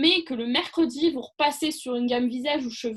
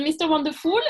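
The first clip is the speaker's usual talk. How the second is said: higher, louder show-off English accent; playful English accent; 4 semitones higher, 5 dB louder than usual. Shown as a demonstration. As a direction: show off your English accent, half-joking. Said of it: "vous avez vu mon accent anglais". (accent anglais appuyé, pour rire)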